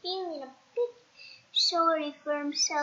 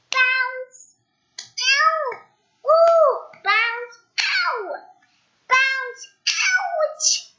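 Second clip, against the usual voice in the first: high, loud ows between bounces; high loud cries of pain; bouncing and yelping; 12 semitones higher, 10 dB louder, much slower than usual. Acted. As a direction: yelp each hit higher. Ball hit, cries of pain.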